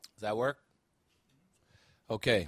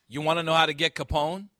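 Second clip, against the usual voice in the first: louder, tough street advice; forceful movie cop; 3 semitones higher, 8 dB louder than usual. Acted. Preacher voicing the cop's Chicago-way speech, louder and tough. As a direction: hammer each line home like a tough cop. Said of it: "Jim Malone says".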